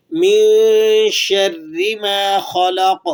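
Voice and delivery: very slow, drawn-out tilawat; Quran recitation. Shown as a demonstration. Teacher introduces the next verse by reciting. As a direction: chant the verse slowly in tajweed. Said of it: "اگلی آیت دیکھ لیجئے".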